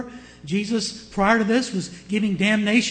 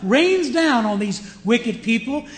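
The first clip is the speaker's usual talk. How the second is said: loud, high gloating proclamation; hammed-up hellfire preaching with glee; mock gleeful preacher; 6 semitones higher, 7 dB louder than usual. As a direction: imitate a preacher relishing judgment. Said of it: "It's almost like they're gleeful over it".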